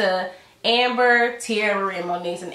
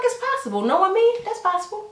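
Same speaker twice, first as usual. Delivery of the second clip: high coy mimicry of the quote; much higher mocking retake; 11 semitones higher than usual. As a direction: pitch it way up, play innocent. Same quote in mock voice.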